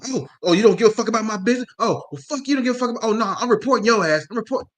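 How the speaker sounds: high, loud indignant ranting; playing the offended lady; outraged customer. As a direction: threaten to report in a huff.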